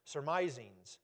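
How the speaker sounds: measured, solemn Bible reading; scripture reading. Acted. Pastor reading scripture to the church, solemn and measured. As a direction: read the verses out to the congregation.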